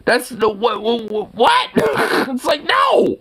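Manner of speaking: high incredulous yelp with a laugh; flabbergasted shout. Retold or imitated. Bisexual vlogger mocking confused questioners, shrieking in disbelief.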